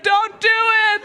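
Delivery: high, urgent cry; pleading heart. Acted. Heart begging the fist to stop, high and urgent. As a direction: beg desperately as the heart. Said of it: "my heart was like".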